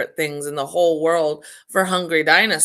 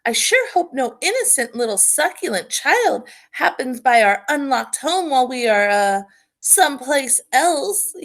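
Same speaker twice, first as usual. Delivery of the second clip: higher, sly dinosaur mother voice; mock-innocent scheming dinosaur; Mama Dinosaur; 7 semitones higher than usual. A narrator's reading. Mama Dinosaur hinting to her family, sly and higher. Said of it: "continued Mama Dinosaur".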